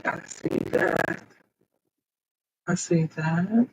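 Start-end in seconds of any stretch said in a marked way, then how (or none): 0.0-1.2 breathy whisper, slowed down; whispered aside. Real, not acted. Streamer whispering to herself over her settings. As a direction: murmur it to yourself while reading the screen.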